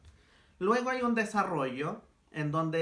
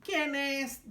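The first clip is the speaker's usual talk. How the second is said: a higher mock granny voice from the wolf; the wolf faking grandma, pitched up; wolf imitating grandma; 10 semitones higher than usual. Wolf pretending to be grandma for Caperucita, mock high granny. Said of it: "dice el lobo imitando la voz de la abuelita".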